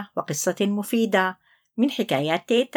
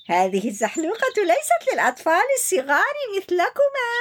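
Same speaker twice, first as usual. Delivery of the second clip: laughing; 10 semitones higher, faster than usual. Acted. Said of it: "ضحك عنتر وقال".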